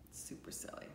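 put-on breathy whisper to the kids; whispered aside. Shown as a demonstration. Teacher whispering to the children: playful whisper. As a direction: lean in and whisper it.

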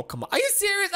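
ordinary delivery

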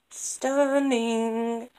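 overjoyed exclamation; excited. Real, not acted. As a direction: gush the word with delight.

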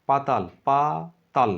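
পাতাল then পা, তাল as separate beats; syllable split. Shown as a demonstration. Teacher splitting পাতাল for the class. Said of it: "পাটা আলাদা আসছে".

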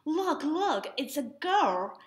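put-on a touch of eager children shouting; excited children. A narrator's reading. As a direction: call out like thrilled kids. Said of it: "excited children who cried out".

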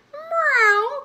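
high, loud drawn-out meow; kittens' cry. A narrator's reading. Three kittens answering their mother, high meow. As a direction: mew it out high like little kittens. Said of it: "cried the three".

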